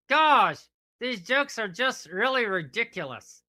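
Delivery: ordinary delivery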